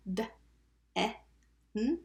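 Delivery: "D, E, N" as three isolated letter sounds; sounded out. Shown as a demonstration. Teacher breaking down first syllable, sounded out. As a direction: tap out "den" sound by sound.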